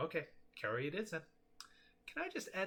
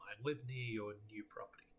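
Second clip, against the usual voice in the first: ordinary talk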